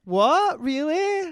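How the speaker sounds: high, loud shocked disbelief; surprised. Real, not acted. Shocked reaction to reveal.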